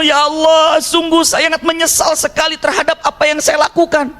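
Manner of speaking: high-pitched, sustained cry of regret, retold; penyesalan mendalam. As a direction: act out a remorseful plea to God, pitched high. (seruan menyesal bernada tinggi, menirukan)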